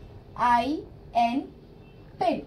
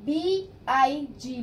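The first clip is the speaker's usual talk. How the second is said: slow spelling; much slower than usual. Shown as a demonstration. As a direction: spell बी आई एन slowly, letter by letter. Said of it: "sorry, big". (अक्षर-अक्षर धीरे बोलना)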